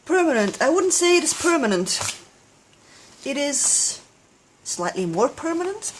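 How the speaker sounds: emphatic. Real, not acted. Mock-dramatic verdict, loud high.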